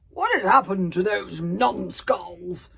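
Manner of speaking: slow, loud booming roar. A narrator's reading. Gobber roaring at the missing boys. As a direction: bellow it out slowly. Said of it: "roared Gobber".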